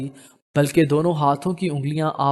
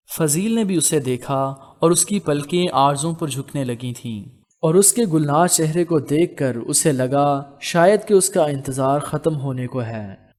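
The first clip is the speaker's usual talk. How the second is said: plain reading voice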